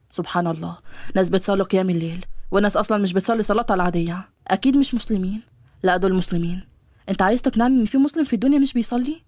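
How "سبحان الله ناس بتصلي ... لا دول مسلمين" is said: low male character voice, wondering; musing young man. Acted. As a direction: play the man reflecting, pitched down. (صوت شاب منخفض متعجب)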